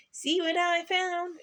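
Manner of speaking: high, slow, excited show-off; excited exclamation. A narrator's reading. Puppy showing mother his boot, excited. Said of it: "exclaimed Pokey".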